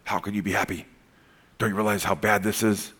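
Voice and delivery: low, soft voice of a gloomy naysayer; a hint of a doubter's murmur; mock joy killer. Pastor voices a joy killer to the church, low and soft. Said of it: "joy killers that'll come in your life".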